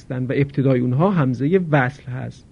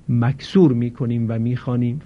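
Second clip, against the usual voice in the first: usual speaking voice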